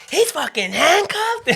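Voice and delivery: very high, slow mock outrage; mom going off. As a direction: imitate mom's drawn-out indignant squeal. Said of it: "she starts going off on a tangent".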